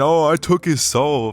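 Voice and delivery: slow, mock-smug boast, lightly voiced; mocking Garry's boast. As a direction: drag it out like a show-off bragging. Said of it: "it's weird he's glazing that win".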